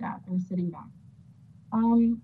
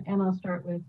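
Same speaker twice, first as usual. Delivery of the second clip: ordinary talk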